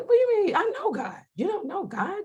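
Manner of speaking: high-pitched mock spiritual snobbery; condescending. Acting out her prideful old self to people, condescending. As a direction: talk down to them, pitched high. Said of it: "I was kind of condescending".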